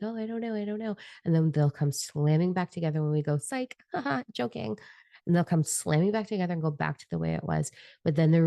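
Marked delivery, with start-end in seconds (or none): 0.0-1.0 high, soft mimicry of a dithering crowd; mock helplessness. Mimicking everybody unsure what to do, mock helplessness. Voices everybody's panicked uncertainty. 3.4-4.8 high, soft taunt with a laugh; mock teasing. Schemers revealing their trick to the crowd, mock teasing.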